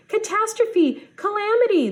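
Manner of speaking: high, loud frantic duck cry; hollering duck. Acted. Snow duck hollering at Opus in alarm, high panicked cry. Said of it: "the duck hollered".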